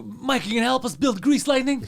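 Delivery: a hint of a fifties greaser; old-timey. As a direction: ham it up like an old musical. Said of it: "it sounded very old-timey".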